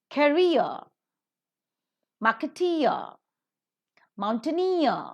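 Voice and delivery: career, marketeer, mountaineer, each ending drawn out; stress on last syllable. Shown as a demonstration. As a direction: lean on the final -eer of career, marketeer, mountaineer. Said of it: "The stress shifts".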